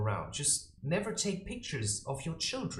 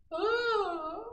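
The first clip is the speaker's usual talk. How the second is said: ordinary delivery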